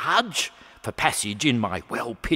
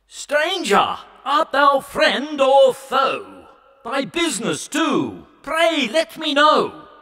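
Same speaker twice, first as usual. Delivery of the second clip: high, stern papal challenge; a pompous Pope calling out to a stranger; the Pope's voice; 10 semitones higher than usual. A narrator's reading. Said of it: "addressed him in the following style".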